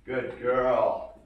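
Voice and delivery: ordinary talk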